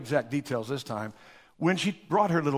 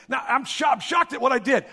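higher, louder anguish; anguished cry; 8 semitones higher, 5 dB louder than usual. Retold or imitated. Judas telling the priests his shock, raised and high. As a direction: cry out in dismay. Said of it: "he says".

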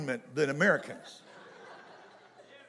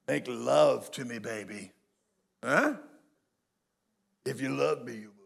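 mock seductive husband; 4 semitones lower than usual. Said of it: "We spell it S E X".

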